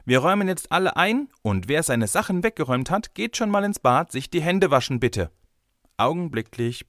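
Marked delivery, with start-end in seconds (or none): 0.0-5.3 high, raised teacher's announcement, easing at the end; calling out. A narrator's reading. Teacher sends the group to wash up, calling out. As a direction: give instructions across a busy room. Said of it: "rief sie".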